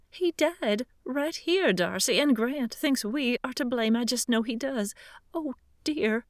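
fluttering, distressed Southern lady begging for help; quavering old lady. Acted. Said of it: "Miss George's quavering voice".

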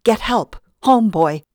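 loud, high urgent shout; yelling. Acted. Captive shouts for her dog to fetch help, loud. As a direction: bark the orders at the dog. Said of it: "I yelled".

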